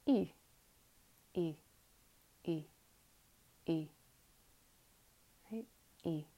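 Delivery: E with lips in a little smile; smiling E. Shown as a demonstration. Teacher demonstrating the smiling vowel. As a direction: spread the lips and voice E. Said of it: "little smile with your lips".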